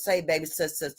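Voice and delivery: low, soft croon to a woman; soft manly voice. Retold or imitated. Good man sweet-talking his woman, low and soft. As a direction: sweet-talk her gently and low. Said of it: "he always got this soft voice".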